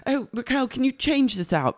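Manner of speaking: high, put-on polite request; lightly voiced boss. Boss handing waitress used napkin, lightly put-on request. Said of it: "She goes".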